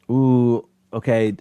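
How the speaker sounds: normal talking voice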